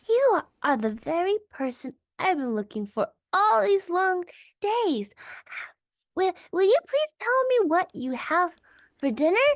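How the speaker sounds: put-on a small child bursting to ask; breathless little elephant child. A narrator's reading. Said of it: "grew all breathless and panted".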